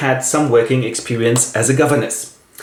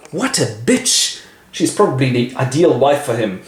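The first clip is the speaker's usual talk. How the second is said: catty aside. Acted. Jealous aside about Blanche.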